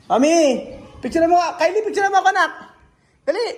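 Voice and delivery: high, loud call to family; excited high-pitched holler. Real, not acted. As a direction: call across for a photo, urgently. Calling family over to take picture.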